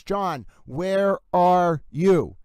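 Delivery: "John, where are you" at half speed, each word separate; slow drawn-out intonation. Shown as a demonstration. Host sounding out a voicemail phrase, slow and deliberate. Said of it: "get through the intonation".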